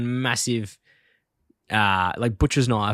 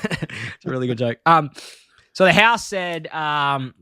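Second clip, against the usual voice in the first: normal talking voice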